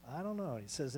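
ordinary delivery

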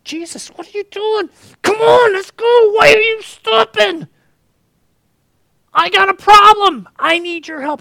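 high, loud, urgent pleading shout; frantic father. Acted. Desperate father shouting at Jesus to hurry, frantic. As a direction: yell it like a desperate dad pushing Jesus.

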